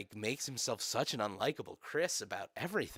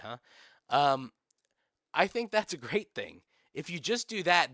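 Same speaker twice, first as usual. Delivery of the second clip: ordinary talk, nothing marked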